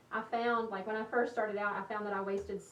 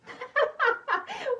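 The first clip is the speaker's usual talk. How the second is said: loud high-pitched laugh that falls away; real laugh at a viewer's joke; high burst of laughter; 7 dB louder than usual. Real, not acted. Reacting to a funny comment.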